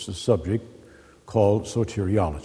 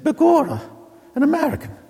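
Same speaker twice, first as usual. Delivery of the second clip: hammed-up Irish "Begorra", much higher and louder; mock Irish exclamation; 4 dB louder than usual. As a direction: play a stage Irishman, surprised and loud. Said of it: "You do that American accent very well".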